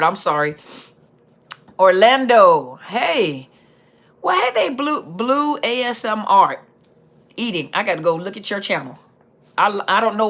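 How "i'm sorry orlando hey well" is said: ordinary delivery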